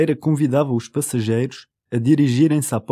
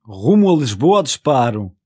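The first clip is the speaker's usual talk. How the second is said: shouted; 5 semitones lower than usual. Acted.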